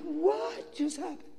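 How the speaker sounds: high, hushed, slow disbelief; retold astonishment. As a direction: ask it stunned, drawn out high and soft. His own stunned reaction, retold.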